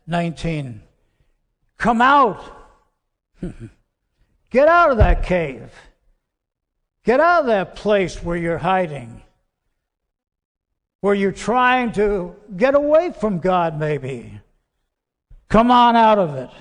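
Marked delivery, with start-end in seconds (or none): 1.8-7.4 loud, higher call into the cave; booming summons from outside the cave. Acted. God calling Elijah from cave. 15.5-16.5 loud, higher beckoning call; loud call to come on out. Acted. God calling Elijah from cave.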